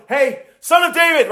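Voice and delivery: plain loud call, not desperate; just call out to get attention; ordinary loud shout. Shown as a demonstration. Preacher showing the first ordinary shout. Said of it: "ordinary loud shout of".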